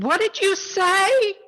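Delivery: high, loud, slow disbelieving question; acted shocked yelp of a question; incredulous. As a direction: stretch it upward in mock disbelief. Jack incredulous at being told let go.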